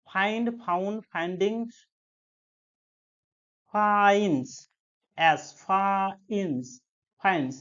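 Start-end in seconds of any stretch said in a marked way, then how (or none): 3.7-6.7 ফাইন্ডস drawn out slowly, final S sounded; pronunciation demo. Shown as a demonstration. Teacher shows class how to read it, stretched ফাইন্ডস with audible S. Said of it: "এরকম ভাবে পড়বে। এসটা কিন্তু উচ্চারণ হওয়া চাই". 7.2-7.6 ফাইন্ডস in one go with final S sounded; pronunciation demo. Shown as a demonstration. Teacher models the word for pupils, ফাইন্ডস with clear final S. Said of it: "এরকম ভাবে পড়বে".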